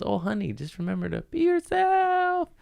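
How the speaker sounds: high, sweet motherly voice; sweet mom voice. Retold or imitated. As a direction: coo it like a doting mom. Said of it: "She goes".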